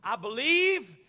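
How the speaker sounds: loud, slow, rising to a shout; preaching shout. Real, not acted. Preacher shouting the fear of God to the congregation. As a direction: preach it out at full force.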